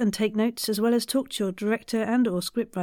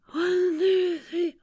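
counted breathing rhythm; 9 semitones higher, slower than usual. Shown as a demonstration. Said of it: "breathe in for three and out for three".

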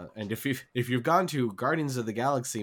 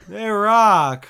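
shouted, high cheer; loud cheer; 9 semitones higher, 13 dB louder than usual. Real, not acted. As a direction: belt it out like a fan. Answering the prompted cheer.

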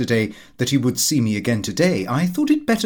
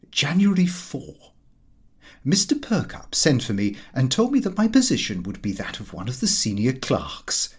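plain reading voice

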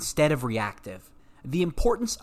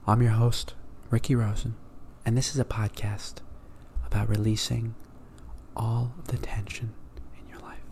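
calm podcast host; 4 dB quieter than usual. Shown as a demonstration. Host mocking a soothing intro, fading to a whisper. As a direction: drift down into a whisper. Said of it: "I spoke like that".

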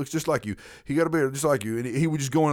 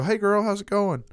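put-on higher, breezy hey girl; fake-friendly greeting; 10 semitones higher than usual. Speaker greeting a woman whose name he forgot, breezily. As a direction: chirp it to cover a blank.

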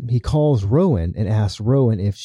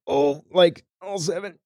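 strained, higher and faint; a heart pang mid sentence; pained gasp; 7 semitones higher, 3 dB quieter than usual. Retold or imitated. Colonel clutching his arm, pained and breathless. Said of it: "he gets the pain in his arm".

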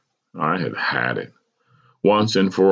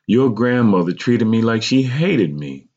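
higher, pained mother's voice; 5 semitones higher than usual. A narrator's reading. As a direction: voice a hurt mother, pitched up. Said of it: "my mother said painfully".

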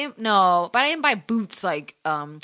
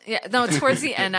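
usual speaking voice

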